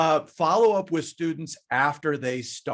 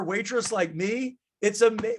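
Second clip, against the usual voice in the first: ordinary talk, nothing marked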